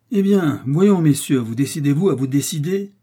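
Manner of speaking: loud, high demand for a decision; impatient shout. A narrator's reading. Jussac pressing the musketeers to decide, impatient shout. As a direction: shout it like the third time of asking. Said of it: "cria pour la troisième fois Jussac".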